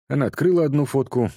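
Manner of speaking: ordinary delivery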